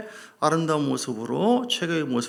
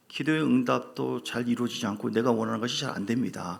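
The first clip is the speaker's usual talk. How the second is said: normal talking voice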